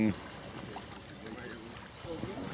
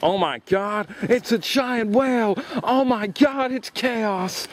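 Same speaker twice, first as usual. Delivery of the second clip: put-on much higher, gushing American accent; mock American accent; 10 semitones higher, slower than usual. Imagining Americans seeing the whale, in a high mock American accent. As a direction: squeal it like an overexcited American tourist. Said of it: "if it was in America, they'd be like".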